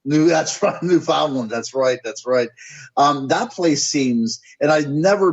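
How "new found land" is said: Newfoundland said as noo-FOUND-lund; echoed alternative pronunciation. Shown as a demonstration. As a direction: echo "Newfoundland" with a full FOUND. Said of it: "I've heard it several different".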